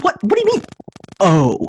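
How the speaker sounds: sudden high alarmed what, pitch jumping way up; startled patient, voice shooting up high. Retold or imitated.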